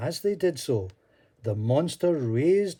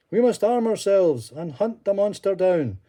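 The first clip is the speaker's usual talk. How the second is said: calling out; 8 semitones higher, 6 dB louder than usual. Acted. Villagers calling to arm.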